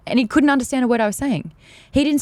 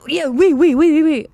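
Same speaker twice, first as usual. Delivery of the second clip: loud, eager "oui, oui" from the French guy; 6 semitones higher, 5 dB louder than usual. Retold or imitated. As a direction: keep piling on the "oui"s, loud and keen.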